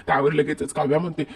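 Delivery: ordinary talk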